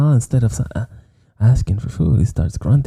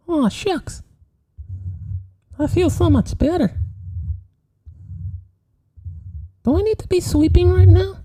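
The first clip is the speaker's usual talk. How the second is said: put-on very high, innocent child falsetto; meek little boy David. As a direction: speak as a sweet, timid little boy. Plays the exorcised boy David.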